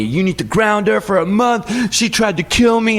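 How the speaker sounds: put-on high, indignant kid demanding; son freaking out. Dad imitates his son demanding punishment, high and indignant. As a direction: whine for justice. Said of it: "He's telling me".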